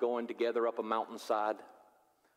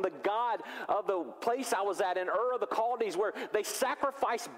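rising anxious plea; 10 semitones higher than usual. Pretend. Abraham pleading with God.

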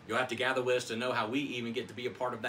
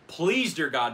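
loud, higher plea to God; crying out to God; 5 semitones higher, 8 dB louder, slower than usual. Acted. Nathanael begging God from under the fig tree, crying out. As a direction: cry it out as a desperate prayer. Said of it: "he's crying out to God".

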